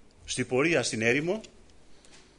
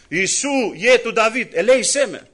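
higher, louder cry of a blind beggar; calling out; 6 semitones higher, 6 dB louder than usual. Retold or imitated. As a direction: call out over the crowd for mercy. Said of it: "φωνάζει στο πλήθος". (ο τυφλός φωνάζει στο πλήθος, δυνατά)

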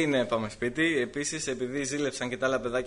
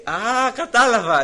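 knowing mother; 10 semitones higher, 11 dB louder, slower than usual. Retold or imitated. Mother realises, retold.

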